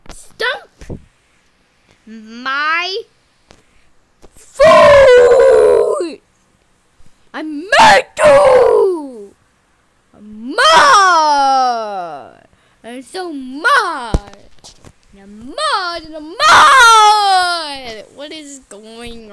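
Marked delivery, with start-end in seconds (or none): 0.1-6.2 "Stomp", "my", "foot" split by long pauses, "foot" shouted long; word-by-word tantrum. Pretend. Announcing a foot stomp, "foot" yelled and stretched after pauses. 7.3-18.0 loud, drawn-out mock-angry wail; tantrum. Insisting on being mad too, slow wailing tantrum. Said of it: "is she having a tantrum".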